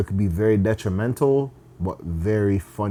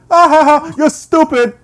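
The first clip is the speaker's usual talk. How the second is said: very high, loud put-on laugh; mocking laughter; 9 dB louder than usual. Man laughing at her on set, loud mocking laugh. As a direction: cackle and jeer in a high voice. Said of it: "He was laughing at her".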